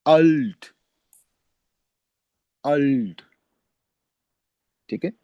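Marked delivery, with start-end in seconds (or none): 0.1-3.2 अल्ट said with an open a; German word alt. Shown as a demonstration. Teacher models the German word, clear open a. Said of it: "ठीक है, अफल".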